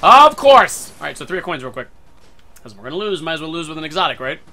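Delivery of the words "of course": loud, high cry of exasperation; exasperated outburst. Real, not acted. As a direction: blurt it out loudly, fed up. Exasperated outburst at forgotten step.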